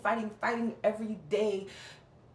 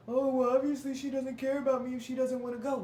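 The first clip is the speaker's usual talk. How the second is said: higher mock-aggrieved complaint; mocking imitation; 5 semitones higher than usual. Vlogger mimicking his reaction to her refusal, mocking. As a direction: imitate someone making it all about themselves.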